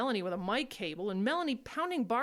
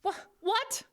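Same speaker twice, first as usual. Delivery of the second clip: shocked disbelief; 8 semitones higher, 4 dB louder than usual. Acted. Shocked at the Barb plan.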